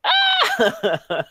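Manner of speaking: high shrieking scream breaking into laughter; scream, then crack up; anime scream. Acted. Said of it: "classic anime scream".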